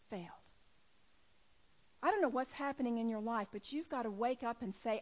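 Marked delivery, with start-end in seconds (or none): none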